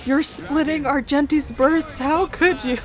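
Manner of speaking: high, dramatic fake disbelief; mock outraged fan. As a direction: wail it like a betrayed fan. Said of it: "people are like".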